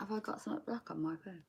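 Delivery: quick whisper to herself; fast whisper. Real, not acted. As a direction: rush it out under the breath. Muttering while checking phone.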